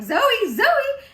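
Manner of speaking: high, loud, alarmed cry of a name; re-enacted panic, yelled high; panicked call. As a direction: shout as if trying to rouse someone unresponsive. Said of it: "And then with panic".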